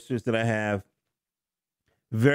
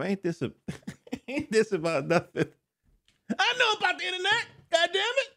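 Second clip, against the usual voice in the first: high, fast, squeaky indignant voice; mock indignant father. Father scoffing at his son's meme remark, high mock indignation. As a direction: squeal it out like an offended dad. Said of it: "I'm thinking to myself".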